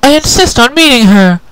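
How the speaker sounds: high, loud and indignant; annoyed. Acted. Twilight insists to the guard, annoyed and loud. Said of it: "Twilight Sparkle's voice sounded very annoyed indeed".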